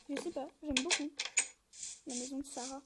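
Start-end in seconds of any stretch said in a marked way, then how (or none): none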